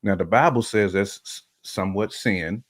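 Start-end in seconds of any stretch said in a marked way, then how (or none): none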